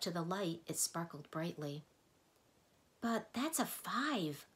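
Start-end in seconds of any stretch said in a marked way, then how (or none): 3.0-4.5 higher, slow puzzled protest; objecting. A narrator's reading. Milo objects to the Mathemagician, higher and puzzled. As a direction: point out the obvious. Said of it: "objected Milo".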